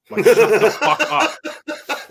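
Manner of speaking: high, loud, slow laughing rant; laughing outburst. Real, not acted. Venting to friends about the resident, laughing. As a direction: laugh it out, stretched.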